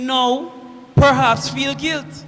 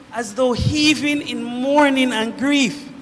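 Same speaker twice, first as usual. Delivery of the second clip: ordinary talk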